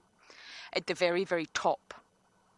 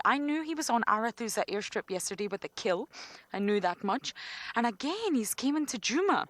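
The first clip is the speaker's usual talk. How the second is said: excited; 4 semitones higher, 6 dB louder than usual. Real, not acted. Excited guide spots leopard.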